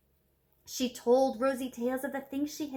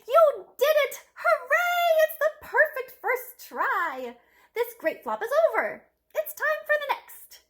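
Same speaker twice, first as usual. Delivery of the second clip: much higher, louder, elated; joyful cheering; 13 semitones higher, 8 dB louder than usual. Acted. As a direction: cheer her on with delight. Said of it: "started to cry".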